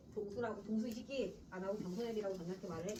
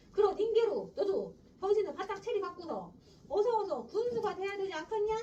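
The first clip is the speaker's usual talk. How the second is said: put-on higher, louder old man pushing his grandson; a scolding grandfather voice, raised and insistent; nagging grandfather; 8 semitones higher, 5 dB louder than usual. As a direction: press him like a pushy grandfather.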